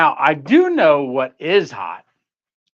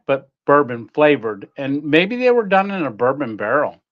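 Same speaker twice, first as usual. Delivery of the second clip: normal talking voice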